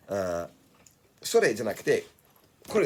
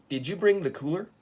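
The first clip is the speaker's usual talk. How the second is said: "did you bring the cooler" higher, rising on "cooler"; native model question; 7 semitones higher, 3 dB louder than usual. Shown as a demonstration. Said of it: "ネイティブの喋り方と発音を".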